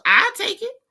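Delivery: high-pitched eager grab; playful high squeak; squeaky high claim. Pretend. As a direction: squeal it like a kid. Claims the two dollars.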